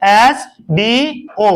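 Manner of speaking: very slow, each letter stretched: MLA; slow spelling out. Shown as a demonstration. Teacher spells abbreviation for children, stretched slowly. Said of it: "drawn out for children".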